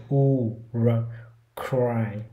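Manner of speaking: "u, r, r, cry": a slow rounded u, held r, then cry repeated; three-step r drill. Shown as a demonstration. Teacher drills cry in three steps. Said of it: "các bạn cũng làm ba bước".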